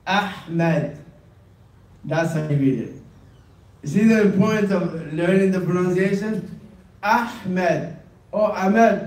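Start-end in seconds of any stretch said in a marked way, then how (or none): none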